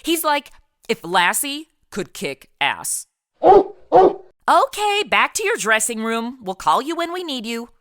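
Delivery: ordinary talk, nothing marked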